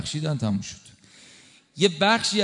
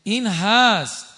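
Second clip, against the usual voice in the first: higher and louder, building; impassioned climax; 8 semitones higher, 3 dB louder than usual. Real, not acted. Preacher to congregation, passionate rising emphasis.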